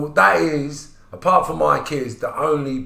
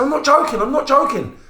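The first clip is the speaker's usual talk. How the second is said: much higher, louder, pressing insistence; insisting friend; 6 dB louder than usual. Retold or imitated. Friend on the phone insisting it is no joke, excited. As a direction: convince him it's true.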